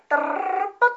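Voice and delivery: high sung jingle; victory jingle. Shown as a demonstration. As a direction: mimic the game's victory tune. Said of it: "細かすぎて伝わらないモノマネ".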